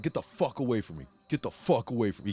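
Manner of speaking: ordinary delivery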